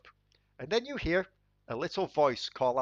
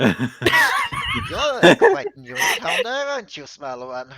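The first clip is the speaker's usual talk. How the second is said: slow, drawn-out Scottish gnoll; Scottish accent; 10 semitones higher, 10 dB louder, much slower than usual. Acted. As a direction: talk down to the little one in Scottish. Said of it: "God, she's Scottish".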